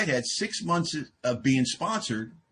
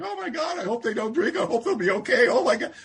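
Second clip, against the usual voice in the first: much higher, hand-wringing mock panic; mock fretting sponsor; 10 semitones higher than usual. Mocking sponsors who fret over newcomers, high mock panic. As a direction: parody an overanxious sponsor fussing. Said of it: "get all emotional about sponsoring people".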